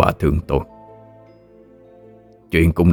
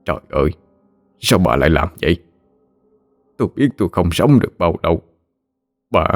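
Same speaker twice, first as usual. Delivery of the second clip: tearful; 3 dB quieter than usual. A narrator's reading. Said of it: "Ông rưng rưng nước mắt".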